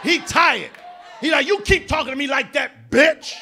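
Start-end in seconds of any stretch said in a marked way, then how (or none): 1.5-3.4 louder, higher warning snap; mock angry threat; fed-up man. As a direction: finally lose patience. Man fed up snaps back.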